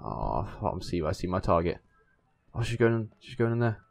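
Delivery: ordinary talk, nothing marked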